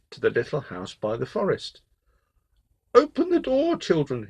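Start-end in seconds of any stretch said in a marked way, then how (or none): 2.9-4.3 very high, put-on sweet call; wolf faking a mother's soft voice; soft-voiced wolf. A narrator's reading. Wolf with syrup-softened voice calls to the goats, high and soft. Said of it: "called in his new soft voice".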